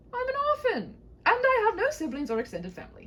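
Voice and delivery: a bold knight calming a frightened maiden; gallant knight. Acted. Knight reassuring the maiden, high and grand.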